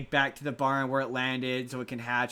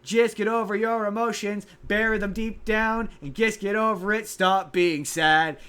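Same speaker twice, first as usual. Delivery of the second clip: high, louder mock-cheerful order, slowing on the end; mocking Barney song; 6 semitones higher, 5 dB louder than usual. Mocking Barney's song telling kids to bury their feelings, high and loud. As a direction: scoff through the song's message. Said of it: "It's like".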